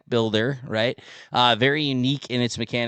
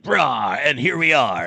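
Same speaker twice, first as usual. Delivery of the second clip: burly cats; 4 dB louder, slower than usual. Pretend. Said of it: "burly sounding".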